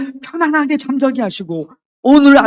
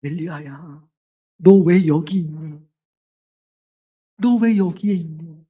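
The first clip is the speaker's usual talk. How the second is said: soft, slow and much lower, tenderly calling Elijah by name; a grieving father gently asking his child; gentlest voice; 5 semitones lower, 5 dB quieter than usual. Acted. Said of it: "가장 부드러운 목소리로". (가장 부드럽고 안타까운 목소리)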